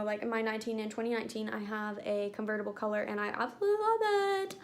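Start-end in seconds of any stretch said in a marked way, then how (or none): 3.3-4.5 high-pitched gush of love; delighted, rising high. Real, not acted. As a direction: let the pitch soar as you gush. Gushing over a favorite product.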